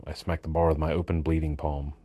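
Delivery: usual speaking voice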